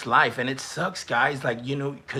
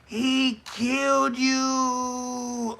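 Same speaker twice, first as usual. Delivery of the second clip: slow, much higher, drawn-out gasp of shock; shocked disbelief; 11 semitones higher, much slower than usual. Real, not acted. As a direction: stretch it out in stunned disbelief. Streamer reacts to chat roast.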